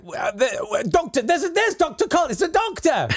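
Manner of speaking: high, eager cries; excited crowd. Retold or imitated. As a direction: call out as a hopeful crowd. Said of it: "everyone was like".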